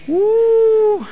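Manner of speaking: a high, stretched mock "Uh" then a dry aside; playfully voicing the wife's jab about old times; wife's teasing quip. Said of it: "Diría mi esposa". (imitando a la esposa con un "Uh" agudo y burlón)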